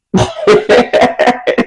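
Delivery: breathy, voiceless laughing; amused storyteller's laugh. Real, not acted. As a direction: crack up at your own story. Laughs at her own story.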